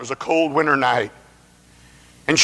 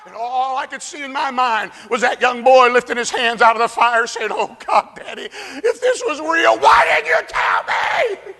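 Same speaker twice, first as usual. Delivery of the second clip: full-force preaching; 11 semitones higher than usual. Acted. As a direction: cry it out in horror. Preaching vision of hell.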